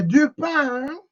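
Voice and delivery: respuesta enojada mucho más aguda y fuerte; enojo. Pretend. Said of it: "la gente se encojona y dice". (gente enojada que responde du pain a gritos)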